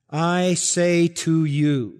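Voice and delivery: every word of "I say to you" stretched out slowly; weighty, drawn-out stress on "I say to you"; emphatic. Retold or imitated. Preacher quoting the Lord to the congregation, "I say to you" emphatic and drawn out. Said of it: "that's emphatic".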